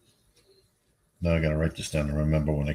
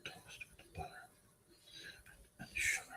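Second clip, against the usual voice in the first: breathy whisper to herself; 24 dB quieter than usual. Real, not acted. Reading the chat's odd recipe aloud, whispering. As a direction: whisper it, testing the idea. Mulling a chat suggestion.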